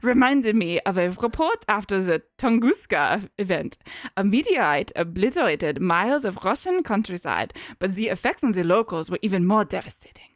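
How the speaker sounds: sustained put-on German accent. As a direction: recount it as a German scientist. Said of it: "had to keep going with that".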